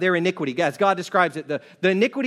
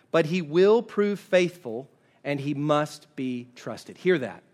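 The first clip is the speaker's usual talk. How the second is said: ordinary delivery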